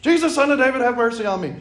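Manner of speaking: high, loud desperate plea; crying out. Acted. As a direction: call out over the crowd. Blind man crying out to Jesus.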